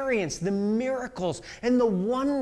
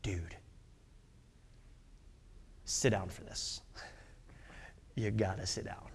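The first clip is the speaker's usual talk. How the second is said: hushed warning; 13 semitones lower, 14 dB quieter than usual. Retold or imitated. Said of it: "They look at him and they're like".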